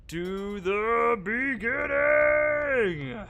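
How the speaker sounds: long frustrated shout, stretched; drawn-out yell. Real, not acted. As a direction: yell it out slowly in despair.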